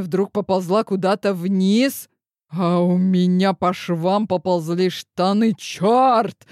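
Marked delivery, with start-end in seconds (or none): 5.5-6.5 sharp, higher, louder curse of shock; startled curse. A narrator's reading. Hero swears aloud as the girl slides down, startled and louder. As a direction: blurt it out, caught off guard.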